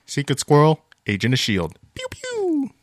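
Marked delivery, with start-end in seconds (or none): 2.0-2.7 high-pitched mock laser pew pew; pew-pew sound effect. Pitching a cartoon title, high mock laser pews. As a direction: squeal out toy blaster noises.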